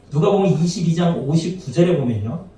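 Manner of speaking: usual speaking voice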